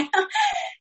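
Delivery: a quick, high laugh of relief; high gleeful laugh. Real, not acted. Relieved woman after court, high gleeful laugh. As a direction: laugh with glee.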